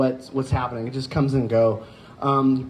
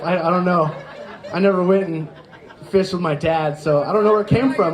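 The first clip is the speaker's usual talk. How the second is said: ordinary delivery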